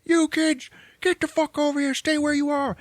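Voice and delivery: high, strained mock yell; angry guard. Guard ordering kids to stay, mock angry yell. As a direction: imitate a guard hollering at trespassers.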